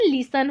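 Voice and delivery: "लिसन" with no T sound; correct listen pronunciation. Shown as a demonstration. Teacher explains the spelling's pronunciation, "लिसन" with a silent T. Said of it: "का pronunciation".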